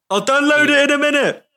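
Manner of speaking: high-pitched, put-on comic voice; mock character yell. Co-host joining the banter, high mock yell. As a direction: call it out high in a silly voice.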